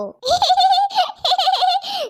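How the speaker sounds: a high laugh bursting out; a big high laugh that drops away; high burst of laughter. Acted. Laughing at her own joke.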